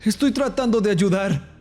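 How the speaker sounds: high, strained narrated shout; shouting to be heard. A narrator's reading. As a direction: call out high and pleading. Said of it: "grité".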